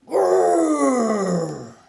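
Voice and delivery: loud growl; bear growl. A narrator's reading. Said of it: "and it came out".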